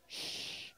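long soft shh; shushing. Real, not acted. Pastor quieting children mid-story, shushing. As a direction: hush the room with a drawn-out shh. Hushes the young audience.

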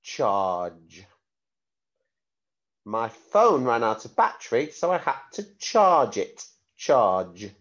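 clear, careful word-sentence-word dictation. Shown as a demonstration. Spelling test dictation.

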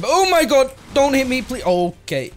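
high frantic panic; panicked. Real, not acted. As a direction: scramble in a blind panic. Boss fight nearly dying.

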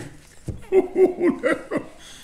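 high, airless wheeze of a laugh; overcome, wheezing laugh; breathless wheezing laugh. Real, not acted. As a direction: laugh silently till it wheezes. Laughing through tears.

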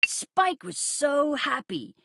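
plain reading voice

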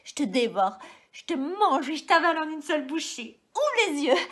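greedy loving outburst, loud; rising passion. Acted. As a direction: swell up into a loud demand. Devouring endearments grow loud.

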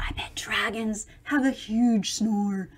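softly whispered guess; hushed whisper; whispered aside. Acted. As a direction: whisper so the dragon stays asleep. Aside about sleeping dragon.

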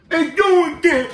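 high mock holler; imitated patrol kid. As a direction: call out in an overexcited kid voice. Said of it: "he said".

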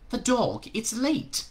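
high, fretful voice; anxious angel. A narrator's reading.

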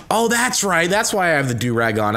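normal talking voice

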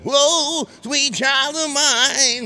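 very high, loud, slow screeched rock wail. Retold or imitated. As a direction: wail it like a hair-metal screamer. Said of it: "It becomes [name]".

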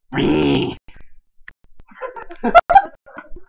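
high wheezing laugh; loud laugh. Real, not acted. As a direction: burst out laughing. Laughing fit after a joke.

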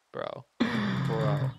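ordinary talk, nothing marked

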